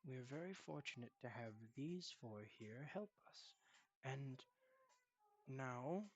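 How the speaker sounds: very soft, soothing grandfather; reassuring grandfather. Acted. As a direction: comfort your grandson quietly, with pauses. Voices Sal reassuring his grandson.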